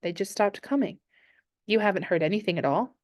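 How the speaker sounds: ordinary delivery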